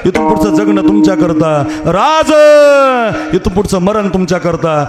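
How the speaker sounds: high, ringing sermon voice rallying the crowd; fiery kirtan preaching. Acted. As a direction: preach it up to the crowd at full force. Preacher leads chant buildup.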